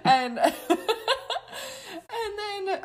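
high, drawn-out laugh breaking the words; helpless laughter. Real, not acted. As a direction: lose it laughing and barely get the words out. Overcome by laughter mid-story.

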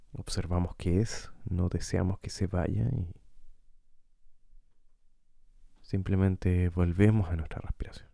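calm guide with long, unhurried pauses; guided meditation. Acted. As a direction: lead listeners gently through breathing, leaving space between phrases. Guía una meditación de respiración. (meditación guiada, tranquila y pausada)

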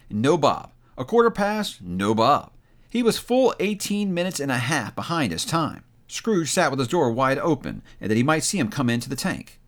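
ordinary delivery, nothing marked

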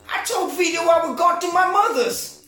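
screechy high-pitched mobster's wife; shrill put-on wife voice. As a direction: caricature a nagging New York wife, way up high. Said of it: "she's calling him Boynie".

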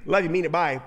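quick throwaway sign-off; rattled-off love you; rushed catchphrase. Retold or imitated. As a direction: toss it off like hanging up the phone. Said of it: "it's kind of like".

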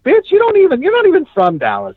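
sudden high, sharp retort; imagined outburst. Retold or imitated. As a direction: snap back at the mouthy fan, pitch jumping up. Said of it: "I'd be like".

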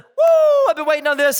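high loud "Woo" then eager; mock whooping joy. Zechariah as he might have reacted, loud gleeful whoop. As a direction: celebrate like the wait is over. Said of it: "His response isn't".

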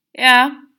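high, loud call through the curtain; calling out from the shower. A narrator's reading. Mother answers her son from the shower, loud call. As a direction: shout back over the running water.

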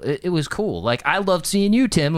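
usual speaking voice

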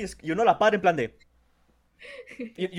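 ordinary delivery

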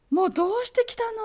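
high, fast, surprised question; teacher's voice. Retold or imitated. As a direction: fire the question off in disbelief.

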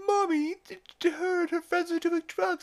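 piping, high mock Stewie snitching; tattling Stewie. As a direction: squeal it up high like a child telling on someone. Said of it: "Stewie's like".

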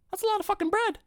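very high squeak of mock shock; high-pitched joking outrage; squeaky mock disbelief. Exaggerated repeat about bread.

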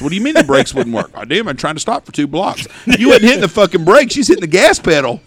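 exasperated son scolding Dad; mock scolding. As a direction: chew him out, half laughing. Chewing out Dad in retold quarrel.

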